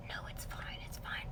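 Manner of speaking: hushed imitation of a friend; barely audible whisper; whispered reassurance. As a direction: whisper it to brush off the worry.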